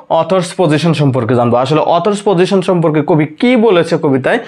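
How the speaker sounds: usual speaking voice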